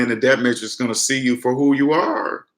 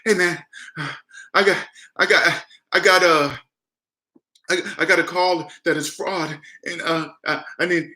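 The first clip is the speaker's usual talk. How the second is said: higher, halting mock-nervous voice; put-on scared voice; 4 semitones higher than usual. Caller telling scammers about a fraud call, feigned scared stammer. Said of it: "This is how I sounded too".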